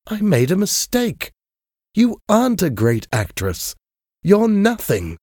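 high, rising, bitter reproach; angry Dorian. A narrator's reading. Dorian rejects Sybil after her bad show, high bitter anger.

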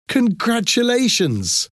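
very high, drawn-out delighted exclamation; excited cry. A narrator's reading. Sister congratulates the organizer on his funding, excited cry. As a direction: cry it out slowly, pitched right up. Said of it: "cried Carlotta".

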